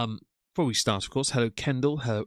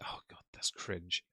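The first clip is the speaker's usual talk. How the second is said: whispering; 4 semitones lower, 12 dB quieter than usual. Real, not acted. Embarrassed aside to chat.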